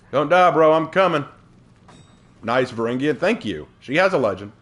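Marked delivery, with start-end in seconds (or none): 0.1-1.2 higher, loud reassurance to a teammate; urgent calling out. Pretend. Player rushes to save a teammate, urgent call. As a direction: call across the battle urgently.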